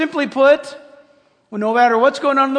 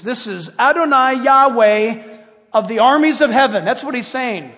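ordinary talk

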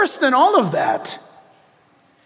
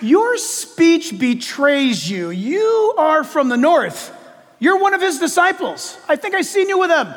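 bystander accusing Peter in a raised voice; accuser; 8 semitones higher than usual. Acted. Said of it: "someone else came by and said".

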